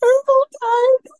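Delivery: very high, slow, breaking voice; wife telling her husband through tears, high and slow; tearful. Retold or imitated. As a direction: let it crack like you're still crying. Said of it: "And I said".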